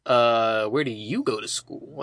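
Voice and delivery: low mimicry of the boy; imitating his talk. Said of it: "that's how he talks like this".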